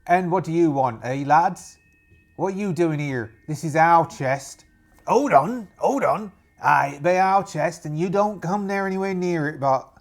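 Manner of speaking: put-on loud pirate accent of no fixed region; unidentifiable put-on accent. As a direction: challenge the intruders in a muddled pirate accent. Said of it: "what that accent was".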